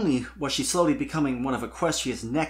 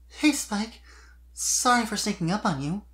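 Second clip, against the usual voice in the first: put-on higher, gentle girlish voice; soft young mare; 9 semitones higher than usual. A narrator's reading. Sweetie Belle greeting Spike softly from the bushes. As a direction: ease in softly so you don't startle him. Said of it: "came the soft voice".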